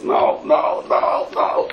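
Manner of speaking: strained, voiceless string of protests; acted panicked refusal. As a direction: plead in mock dread. Boy dreading public benching, refuses.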